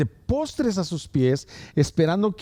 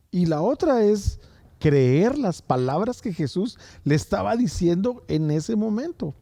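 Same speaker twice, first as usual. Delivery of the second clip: high, ringing sermon climax; rising fiery preaching; 7 semitones higher than usual. Acted. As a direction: lift the church with the story. Preaching rises to full force.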